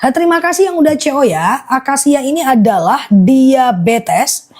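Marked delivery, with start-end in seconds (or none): 1.7-4.5 slow repeat. Real, not acted. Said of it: "aku ulang akasia fokus ya".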